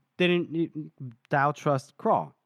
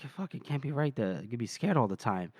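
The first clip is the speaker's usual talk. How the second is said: low, quieter grumble under the breath; muttering to himself; 4 semitones lower, 5 dB quieter than usual. Retold or imitated. Craw forgets Shivers is with him, muttering to himself. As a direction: mutter it privately, pitch dropped. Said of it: "is, like, muttering to himself".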